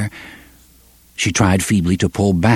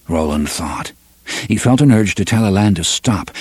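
ordinary delivery

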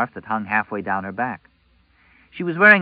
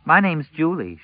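put-on high girl voice, bright and eager; a young girl introducing herself; 7 semitones higher than usual. A narrator's reading.

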